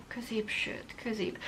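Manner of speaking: whispering the word to herself, unsure; a hushed puzzled echo. Retold or imitated. Repeating the lady's word back in a baffled whisper.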